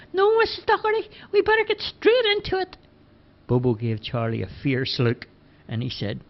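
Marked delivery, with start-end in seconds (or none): 0.1-2.8 put-on high, louder boyish voice; Charlie's voice, eager. A narrator's reading. Charlie pleading with Bobo, high eager boyish voice. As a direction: pitch it up, keen to get going. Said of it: "Charlie immediately replied".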